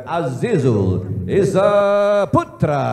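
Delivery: long, loud ring announcer call; drawn-out name callout. Acted. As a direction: stretch the fighter's name out big. Announcer calls out fighter's name.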